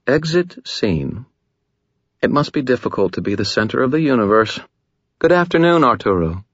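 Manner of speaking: a languid, amused vampire lady toying with the room; seductive Lara voice. Acted.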